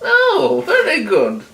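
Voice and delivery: high granny, mollified; granny warming up. A narrator's reading. Granny warming to the wolf as she makes tea, approving. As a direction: let the old lady soften with approval. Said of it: "warming to the wolf".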